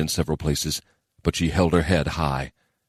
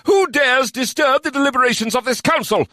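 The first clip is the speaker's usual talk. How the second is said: commanding demand; 4 dB louder than usual. Acted. Demand at the intruder.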